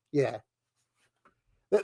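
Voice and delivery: usual speaking voice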